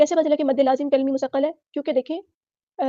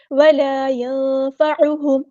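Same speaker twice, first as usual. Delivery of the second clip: slow melodic chant, drawn out; Quran recitation; 5 dB louder, much slower than usual. Shown as a demonstration. Teacher models the verse for students, chanting. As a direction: intone the verse like a qari.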